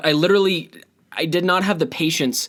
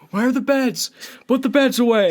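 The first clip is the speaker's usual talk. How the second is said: frantic mom; 10 semitones higher than usual. Retold or imitated. Frantic mom bit continues.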